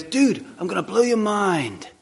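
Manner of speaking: lightly voiced intense friend; a hint of an eager buddy promising a revelation; mild imitation of a keen fisherman friend. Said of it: "he goes".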